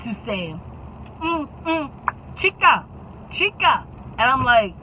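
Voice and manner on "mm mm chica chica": tutting bus driver, higher pitched; scolding driver. Retold or imitated. Driver Lucinda tuts at the screaming boy, mock-scolding. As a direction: click your tongue at the kid. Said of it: "Lucinda has the audacity to say".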